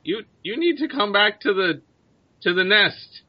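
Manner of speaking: high-pitched bird creature voice; bird leader. Acted. Bird leader tells adventurers to come along, high creature voice. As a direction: urge them in a high creature voice.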